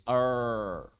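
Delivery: "er" long and untrilled, as in English. Shown as a demonstration. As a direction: hold "er" without any tongue vibration. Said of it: "R Inggris". ("er" seperti R Inggris)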